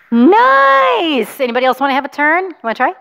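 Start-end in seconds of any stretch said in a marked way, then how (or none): 0.1-1.4 high, loud burst of "Nice!"; excited praise. Real, not acted. Praising a child blowing pinwheel.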